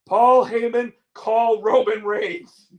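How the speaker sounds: high, loud, drawn-out mock commentator call; Wade Barrett. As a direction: imitate an excited commentator, stretching the words. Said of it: "And then Wade Barrett going".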